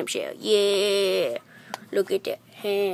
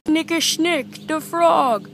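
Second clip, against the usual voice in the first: higher, slow cast roll-call; movie credits announcer; 7 semitones higher, slower than usual. Acted. Kid reading the cast list, announcer voice. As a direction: announce each name like closing credits.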